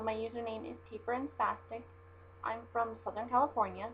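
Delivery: ordinary talk, nothing marked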